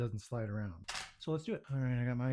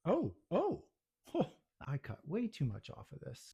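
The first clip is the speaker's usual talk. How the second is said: usual speaking voice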